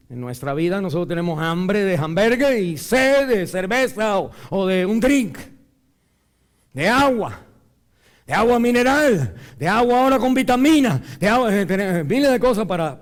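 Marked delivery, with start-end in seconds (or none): 2.8-13.0 building higher and louder; rising emphatic list. Real, not acted. Preacher mocking the congregation's endless thirsts, building louder. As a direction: pile it on, mocking the choices.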